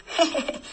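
a brief laugh at her worry; short amused laugh. Acted. Husband laughs at his wife's spy worry, short laugh.

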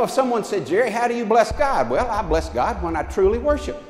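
ordinary talk, nothing marked

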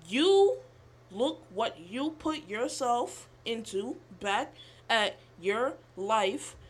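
slow, emphatic, disjointed reading; halting read-out. Real, not acted. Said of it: "that's why I sound like that".

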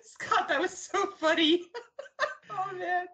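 high, worn out from laughing; helpless laughter. Real, not acted. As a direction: gasp the words through real laughter. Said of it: "Sorry, I'm still".

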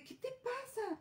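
high, soft shocked gasp of a question; as if stunned by the slap. Retold or imitated. Witness to a mother slapping her child, high shocked question.

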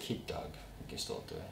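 breathy unvoiced whisper, half to himself. Real, not acted. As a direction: whisper it like a secret plea. Aside about keeping Doug Bradley.

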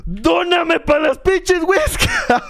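high, slow, drawn-out begging yell; high, slow hammed-up plea for whiskas; mock begging yell. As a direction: plead for cat food money, hammed up. Begs donations as the cat avatar.

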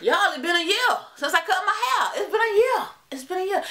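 high, loud, excited opener; excited announcement. Real, not acted. Opens video announcing hair anniversary.

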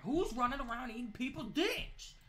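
high, loud disbelieving yell; outraged shout. Real, not acted. Gamer yelling at zombies eating people, loud outrage.

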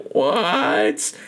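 high, incredulous "what"; retold disbelief. Viewer reacting to Jane being blamed, high-pitched disbelief. Retells own shocked reaction.

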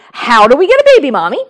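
lightly voiced toddler, pressing his mom; little boy. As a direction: blurt it out like an eager three-year-old. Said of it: "this little boy immediately replied".

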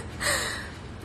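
high laugh. Real, not acted.

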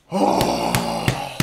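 ordinary delivery

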